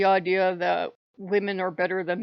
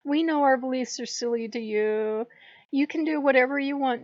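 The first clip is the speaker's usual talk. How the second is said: mock meek acceptance; 5 semitones higher than usual. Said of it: "smile and go".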